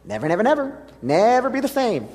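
"never" hit high and loud each time; stressed never. Acted. Pastor drives "never" into the congregation, high and loud. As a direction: hammer "never" home. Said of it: "repeating the powerful phrase".

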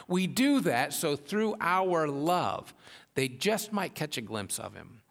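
rising, fervent preacher's voice; preaching. Acted. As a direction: preach it out with building force.

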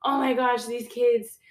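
a loud, high burst of retold alarm; mock panic. Worried adults fretting over kids starting remote school, mock panic. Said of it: "I felt like the adults were panicking".

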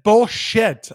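retold angry bark at the agent; angry retort. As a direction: spit it out in disgust. Retold escalating argument on phone.

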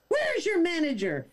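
mock Karen demand. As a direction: insist on the manager.

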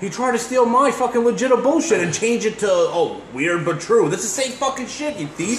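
mock outrage. Real, not acted. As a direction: accuse him, half-joking but heated.

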